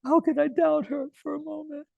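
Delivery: high, hammed-up weepy voice, half laughing; mock crying husband. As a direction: play the foolish husband gushing through mock tears. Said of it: "And Abhimanyu starts crying".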